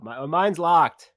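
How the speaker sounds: loud, high, drawn-out yell. Acted. Player's character announces his door to the group, yelling out. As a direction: call it out loud across the room. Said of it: "I just yell out".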